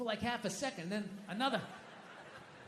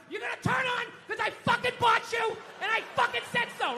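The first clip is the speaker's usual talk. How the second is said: higher, shouting furious at the TV; yelling at the TV; 12 semitones higher, 4 dB louder than usual. Retold or imitated. Dad screaming at the TV that won't turn on, shouting. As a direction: yell it like the TV owes you.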